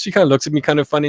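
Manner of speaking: normal talking voice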